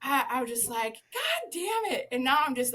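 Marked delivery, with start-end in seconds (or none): 1.1-2.1 retold laughing yell. Zookeeper cursing at her laughing coworker, high mock yell. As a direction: curse high in amused exasperation. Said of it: "yelling at Audra".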